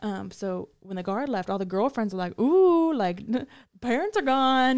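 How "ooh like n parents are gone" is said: high, loud gleeful squeal; excited. Retold or imitated. Girlfriends cheering that the guard left, high and gleeful. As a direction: tease like kids home alone.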